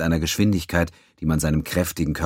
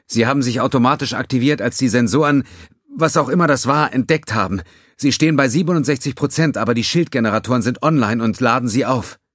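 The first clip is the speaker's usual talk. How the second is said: higher, louder tense report; urgent young officer; 8 semitones higher, 6 dB louder than usual. Acted. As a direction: report damage under pressure. Ensign voice again.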